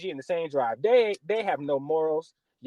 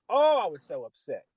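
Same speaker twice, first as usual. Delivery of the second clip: normal talking voice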